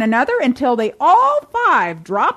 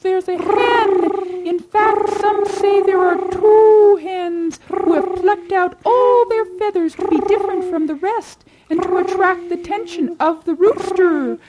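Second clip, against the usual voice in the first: clucking hen gossip; 10 semitones higher than usual. Acted. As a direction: cluck the story knowingly. Chickens pass on the tale.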